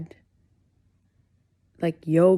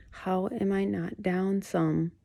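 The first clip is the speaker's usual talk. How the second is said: ordinary delivery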